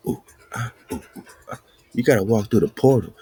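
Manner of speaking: ordinary delivery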